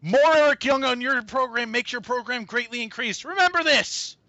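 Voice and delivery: high, loud put-on wrestler promo voice; over-the-top shouted impression; Eric Young. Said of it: "doing the E Y voice".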